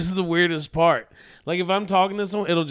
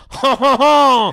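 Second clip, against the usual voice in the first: loud, drawn-out, higher French "ho ho ho"; mock French laugh; 6 semitones higher, 9 dB louder, slower than usual. Comic imagining the French customer, loud mock French laugh. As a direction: bellow a slow, hammed-up French chuckle. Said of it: "What if he just went like".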